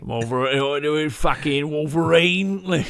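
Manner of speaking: slow, drawn-out Aussie Wolverine; mock Australian accent. Riffing on co-host's Wolverine joke, slow mock Australian accent. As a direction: drawl it in exaggerated Australian.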